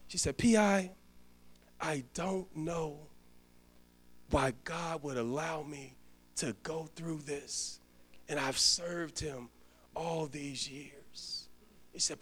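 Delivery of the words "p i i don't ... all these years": quiet, slow, shaken quote of a dying woman; softly voiced grief of a suffering cook; tearful old friend. Dying friend tearfully questioning God.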